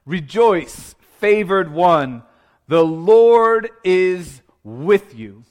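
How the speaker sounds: loud, slow angelic announcement; Gabriel's proclamation. Acted. Angel Gabriel appears and speaks to Mary, grand proclamation. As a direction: proclaim it with long drawn-out words. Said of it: "Gabriel appears, he speaks".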